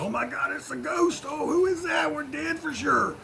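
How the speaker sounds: high, panicky disciples seeing a ghost; mock panic. Disciples in the boat spotting a ghost, high mock panic. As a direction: squeal it out like a frightened fisherman. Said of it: "they're like".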